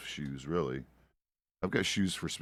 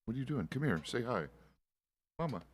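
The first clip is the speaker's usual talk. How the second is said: cooing at a pet; 6 semitones higher, 4 dB quieter than usual. Real, not acted. Talking to pet Mama.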